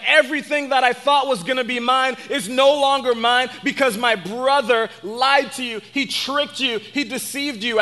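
retold protest of a cheated brother; lightly voiced freak-out, pushed high. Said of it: "Esau starts to freak out".

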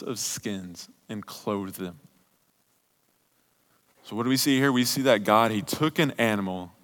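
intimate preaching. Real, not acted. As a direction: draw them in quietly, tender sermon close. Quiet closing sermon continues.

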